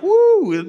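giddy whoop. Retold or imitated. Giddy new romance.

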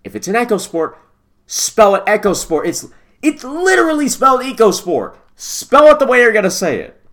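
high, loud, exasperated protest; exasperated rant. Real, not acted. Host scolding the carmaker over a car name's spelling, exasperated. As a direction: build to a high, loud outburst of frustration.

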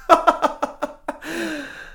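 high gleeful villain cackle; evil laugh. Acted. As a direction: cackle like you've won. Double laughs after claim.

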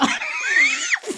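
high squealing laugh; can't hold the laugh in; real laughter breaking out; burst of laughter. Real, not acted. Bursts out laughing at Hawking line.

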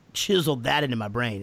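ordinary talk, nothing marked

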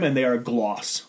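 ordinary delivery

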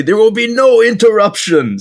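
high, loud mock proclamation; announcer's proclamation. A podcast host quotes the fight announcer in a loud mock proclamation. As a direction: boom it out like an arena herald. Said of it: "they say".

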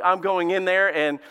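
ordinary delivery

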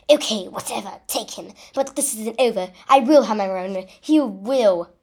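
loud villain's threat; crying out. A narrator's reading. Voldemort giving up the captive, crying out. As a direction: cry out a parting threat. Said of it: "he cried".

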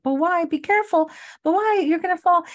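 high-pitched imitation of an anxious warning; acted-out fretting; worried warning. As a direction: pitch it way up, retelling a worried caution. Voicing Amanda's worried warning.

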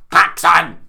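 loud, put-on Pokemon cry; silly voice. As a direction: call it out like a creature's name. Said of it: "That's my other voice".

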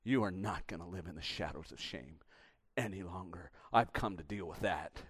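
low, soft, steady promise; tender reassurance. Acted. Jesus telling the woman her shame is over, low and tender. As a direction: speak softly, meaning every word.

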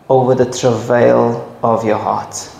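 ordinary delivery

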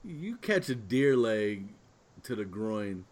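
ordinary talk, nothing marked

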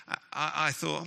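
usual speaking voice

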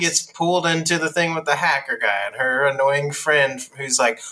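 usual speaking voice

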